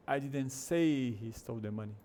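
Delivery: "say" stretched long; stress on SAY. Shown as a demonstration. Teacher repeating the other form, stress on "say". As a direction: lean heavily on "say". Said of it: "Versus a outra forma".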